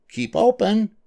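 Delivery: very high-pitched yelled order; little mouse dentist calling out; mock yell. A narrator's reading. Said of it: "yelled Doctor DeSoto".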